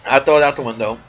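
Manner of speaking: ordinary talk, nothing marked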